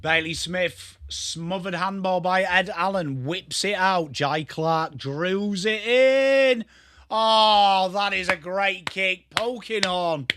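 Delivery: drawn-out commentator call, swelling on the big kick; live football play-by-play. Pretend. Streamer calls the match for the chat, excited play-by-play. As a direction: stretch the key words like a football commentator.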